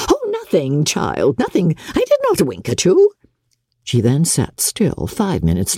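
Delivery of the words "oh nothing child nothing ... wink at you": high, flustered denial; fussy mother. A narrator's reading. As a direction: brush it off in a high fluttery mother's voice. Mrs Bennet denies winking.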